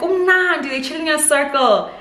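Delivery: high, carefree mock voice of the staff; mocking the ladies. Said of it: "they're laughing, like".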